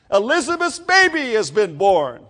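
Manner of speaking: high, loud, excited news; excited announcement. Retold or imitated. Community spreads news of the birth, excited and loud. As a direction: announce it to the whole town. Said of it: "fathom the excitement around the community".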